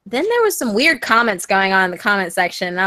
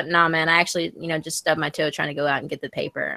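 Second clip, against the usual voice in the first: imitating his serious tone, lower and softer; deadpan serious; 5 semitones lower, 5 dB quieter than usual. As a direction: own up low and straight-faced. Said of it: "he always says everything like it's".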